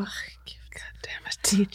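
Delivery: breathy whispered curse. Retold or imitated. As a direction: hiss it under the breath. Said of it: "Jag bara".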